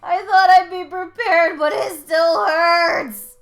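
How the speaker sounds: high, loud tearful cry, slowing down. Real, not acted. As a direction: let the hurt burst out through tears. Emotional reaction to the ending.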